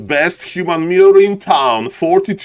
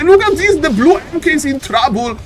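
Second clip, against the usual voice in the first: excited shout; 11 semitones higher than usual. Real, not acted. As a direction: jump up in pitch at a sudden play. Caster sees a sudden fight.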